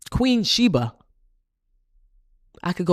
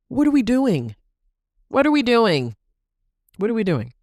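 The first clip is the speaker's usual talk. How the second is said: higher and louder, fed-up rhetorical question; exasperated; 6 semitones higher, 4 dB louder than usual. Real, not acted. Speaker scolding women for cattiness, exasperated. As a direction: call out the pettiness, fed up.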